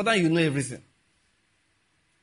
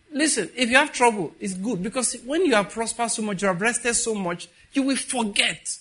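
high, loud preaching appeal; rally the listeners; 7 semitones higher, 3 dB louder than usual. Acted. Preacher warning the congregation, loud preaching.

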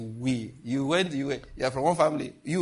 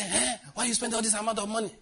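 mock critic; 7 semitones higher, 3 dB quieter than usual. Mocking critics of generosity.

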